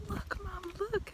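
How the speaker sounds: high, soft little-girl imitation; imitated excited child. Retelling the neighbor girl calling her mom, imitated excitement. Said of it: "just got really excited to see me".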